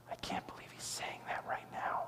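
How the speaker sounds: unvoiced stage whisper. A listener in the pews reacting to the pastor, whispered.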